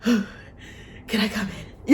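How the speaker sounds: slow whispered plea; breathless whisper. Acted. Colin at the carriage door, slow breathless whisper. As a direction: hush it like a nervous suitor.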